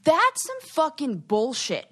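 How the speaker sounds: slow down and lift it in outrage; indignant. Retold or imitated. Recalling her reaction to a dismissive remark, indignant.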